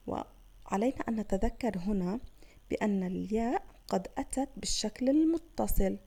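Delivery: ordinary talk, nothing marked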